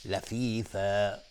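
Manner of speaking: لفیفا said very slowly with a long final alif; teacher modelling لفیفا slowly; drawn-out lafifa. Shown as a demonstration. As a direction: hold the last alif of لفیفا. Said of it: "مشخص بشه اینجا الف هستش".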